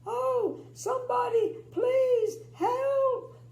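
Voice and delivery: high, loud desperate plea; loud cry for help. A narrator's reading. Miss Tiny cries out to anyone, loud and high. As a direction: beg for rescue with everything. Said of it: "I made it kind of loud".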